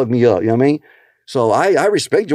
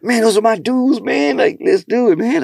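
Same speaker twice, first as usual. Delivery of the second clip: excited; 9 semitones higher than usual. Retold or imitated. DL's eager reply, voiced.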